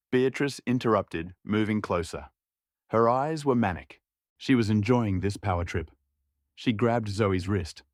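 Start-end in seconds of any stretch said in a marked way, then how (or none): none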